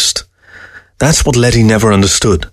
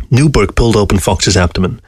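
ordinary delivery, nothing marked

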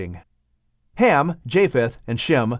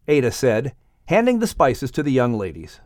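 usual speaking voice

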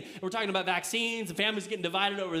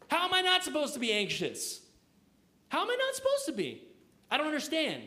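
much higher, pleading voice; exasperated plea; 6 semitones higher than usual. Pretend. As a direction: plead in disbelief. Voicing anxious believer.

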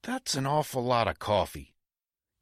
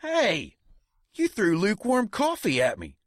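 indignant outrage; 11 semitones higher than usual. Acted. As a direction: protest like you've been wronged. [name] reacts to coffee thrown.

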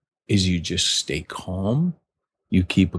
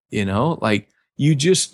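normal talking voice

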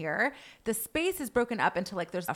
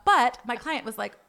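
usual speaking voice

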